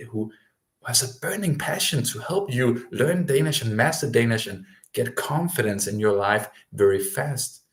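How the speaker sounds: ordinary talk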